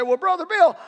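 high-pitched, indignant objector; protesting listener. Acted. Congregant objecting to Brother Bill, high protesting voice. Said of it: "You say".

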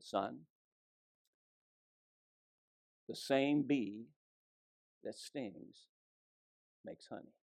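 soft, slow, fading to a whisper; gentle mother. Retold or imitated. Mother telling her stung son a lesson, soft and slow. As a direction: hush it down like a mother sharing a secret.

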